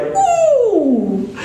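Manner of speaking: high, drawn-out ooh of pleasure; long high ooh of approval; delighted ooh. Retold or imitated. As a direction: coo it way up high. Smiling musician reacts to groove.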